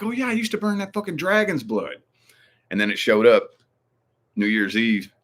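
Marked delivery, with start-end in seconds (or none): 0.0-2.0 very high, drawn-up voice of remembering; delighted realization. Retold or imitated. Streamer remembering the dragon's blood incense, high-pitched realization. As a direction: re-enact the light-bulb moment up high. Acting out own happy recollection.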